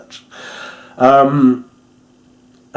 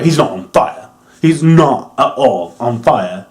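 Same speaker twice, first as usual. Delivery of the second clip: ordinary talk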